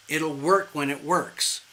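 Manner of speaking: ordinary delivery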